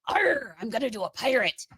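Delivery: lightly voiced mock pirate. Retelling a friend covering the show, a light mock pirate. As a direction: toss off a quick hammed-up arr. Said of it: "doing the pirate voice".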